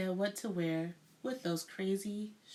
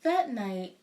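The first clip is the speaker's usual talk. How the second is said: ordinary delivery, nothing marked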